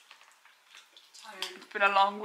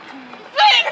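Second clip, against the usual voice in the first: higher, punchy cue; excited shout; 7 semitones higher than usual. Real, not acted. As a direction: shout the swing. Calling the swing at the game.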